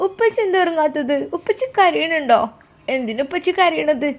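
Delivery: put-on high, childlike voice of a small daughter; little girl voice. Daughter calling her father on the phone, high childlike voice.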